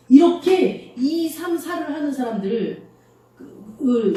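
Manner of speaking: ordinary talk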